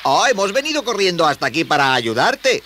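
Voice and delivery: put-on high, loud young voice; indignant, high-pitched youngster; protesting boy. Boy protests to unseen crier.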